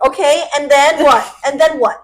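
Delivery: loud, insistent prompting; talking to a child. Retold or imitated. Quizzing a friend on her plan, patronizing. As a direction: press her for the next step like a child. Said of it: "spoke to her like a child".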